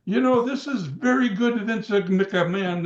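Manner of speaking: imitating the Iron Sheik. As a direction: ham up the Sheik's proud denial, drawn out. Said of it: "And he was serious".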